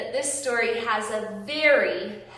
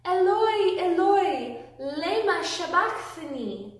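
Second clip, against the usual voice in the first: higher and louder on the first Eloi, easing off by the end; loud cry; 5 semitones higher, 3 dB louder than usual. Acted. Jesus crying out from the cross, mild loud cry. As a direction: cry out to God, but keep it gentle for children. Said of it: "called out in a loud voice".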